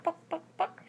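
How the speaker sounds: high playful clucking like a hen; chicken clucking. Retold or imitated. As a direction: cluck it for the toddler, light and high. Mother imitates chicken for toddler.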